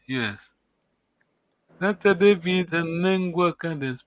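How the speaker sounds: normal talking voice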